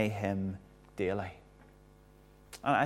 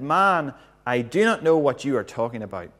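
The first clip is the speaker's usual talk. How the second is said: a raised, louder denial; Peter's heated denial; 3 semitones higher, 7 dB louder than usual. Acted. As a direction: push the words away, voice up. Peter's third denial voiced.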